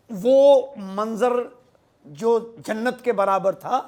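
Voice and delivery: slow, loud, drawn-out verse; poetry recitation. Acted. Poet praises the bright minarets to his audience, slow declamation. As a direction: declaim it slowly, falling away on the last word.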